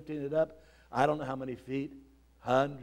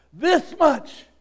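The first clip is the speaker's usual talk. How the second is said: This much higher and louder, falling; loud answer; 11 semitones higher, 10 dB louder than usual. Acted. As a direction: proclaim This much. Jesus answers on the cross.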